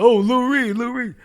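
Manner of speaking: loud shout across the street; calling out. Retold or imitated. Fans on Forty-second Street yelling to [name], loud call. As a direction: holler his name to get his attention.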